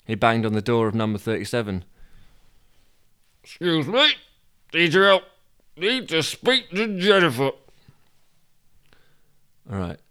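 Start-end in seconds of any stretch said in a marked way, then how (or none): 3.4-7.5 raised, high-pitched urgent request; calling out. Acted. Toby banging on a neighbour's door, calling out. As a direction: call through a closed door.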